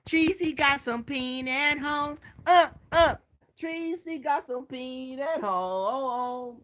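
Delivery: high, slow mock singsong of Tracy's line. Streamer mocks Tracy's words to viewers, high drawn-out mimicry. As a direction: drag it out high, mimicking her. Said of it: "You sitting on there talking about".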